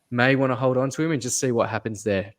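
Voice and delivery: ordinary talk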